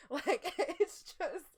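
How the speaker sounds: slow and laughing. Real, not acted. Host laughing to her co-host about men's feelings, laughing.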